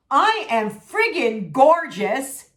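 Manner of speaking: slow, loud affirmation; confident affirmation. Shown as a demonstration. Telling viewers what to say to the mirror, slow and proud. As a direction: say it slowly like you mean it. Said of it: "turn and say to yourself".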